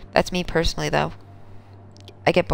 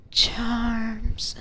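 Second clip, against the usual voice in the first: whispered seductive voice; 5 semitones higher than usual. Pretend. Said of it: "it's just the voice".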